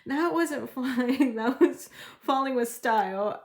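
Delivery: can't get the line out for laughing; laughing through quote. Real, not acted. Tarot reader quoting the movie, laughing out loud.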